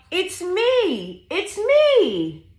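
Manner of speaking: high, sing-song reveal with stretched ME; gleeful high animal voice for kids; excited duck. Acted. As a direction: squeal it proudly as the little duck.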